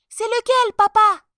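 put-on very high, loud child's voice; little boy. A narrator's reading. Little boy asking father which is the emperor, eager.

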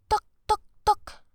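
knocking sound. Acted. Knocking on the door.

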